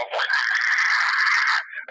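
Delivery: a shrill, breathy screeching dragon cry. Pretend. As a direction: screech like a wyvern taking flight. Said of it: "that is a great dragon sound".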